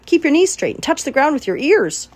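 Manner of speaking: high, loud coaching call. A narrator's reading. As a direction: call the drill across the pen. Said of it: "called Charlotte".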